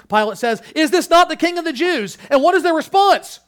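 high, loud, emphatic proclamation; full-force preaching. Real, not acted. Preacher assuring church of the king's reward, full-force preaching.

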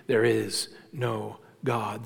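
drawn out and dropped low; slow, hushed creed. Retold or imitated. Preacher quoting the fool of Psalm fourteen, slow and hushed. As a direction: let each word hang in slow disbelief.

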